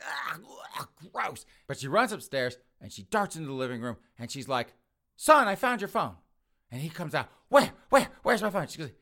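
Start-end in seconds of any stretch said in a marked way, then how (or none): 0.0-1.5 high, strained noises of revulsion; disgusted. Acted. Mother picking up the sticky phone, grossed out. 5.2-6.2 high, loud announcement; calling out. Acted. Mother calling to her son that it turned up, calling out. 7.5-8.7 high, loud rapid questions; eager, urgent. Acted. Son rushing out to his mother for the phone, urgent.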